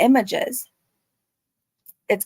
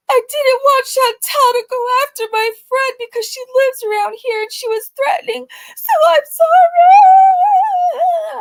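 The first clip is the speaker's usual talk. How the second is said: mock wailing; 14 semitones higher, 5 dB louder than usual. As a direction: sob it out theatrically. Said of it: "She cries".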